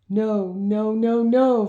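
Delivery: rising, frantic no; distressed. A narrator's reading. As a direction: plead in growing panic. Seeing hurt child.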